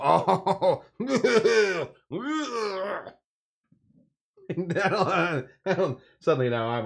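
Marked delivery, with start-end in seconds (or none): 0.0-3.1 a high "Oh" tipping into a laugh; scoff, then laugh at him; mock scoffing laugh. Texan driver laughing at the Californian, mocking. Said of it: "They go".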